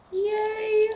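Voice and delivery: bright, high little cheer of delight; excited cheer. Real, not acted. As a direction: cheer as the egg lands perfectly. Sunny egg placed on top.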